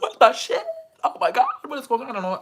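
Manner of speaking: high, loud disbelieving cry; shocked outcry. Retold or imitated. Friends seeing a guy kiss Alex, high shocked cry. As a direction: shriek it in disbelief.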